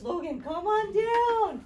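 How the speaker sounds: high, loud, slow game-show call; kids show host. Acted.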